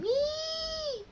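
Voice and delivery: put-on very high girlish squeal; high squeal of joy. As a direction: let out a delighted little shriek. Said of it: "They both scream".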